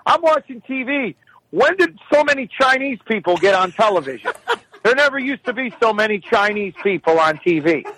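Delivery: high, complaining mock mother; rambling old mother. Mother complaining to son about television, high querulous voice. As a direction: imitate a mother off on a tangent. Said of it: "where she'll go".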